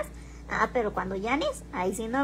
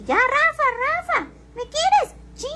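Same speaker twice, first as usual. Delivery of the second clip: very high, louder squealing voice calling Rafa; shrill-free but strongly raised, loud mock voice of a lady begging Rafa; mocking impression of the lady; 7 dB louder, slower than usual. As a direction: mimic a clingy lady pleading for Rafa's love, pitched way up. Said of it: "burlarse de mí de".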